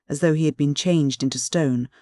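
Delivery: plain reading voice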